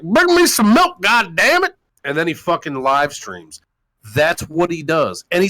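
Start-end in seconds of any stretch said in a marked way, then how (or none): 0.0-1.7 high, loud mock demand; hammed-up shouted order; mock yell. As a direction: bellow it like a lazy dad. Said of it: "yells at one of his fucking daughters".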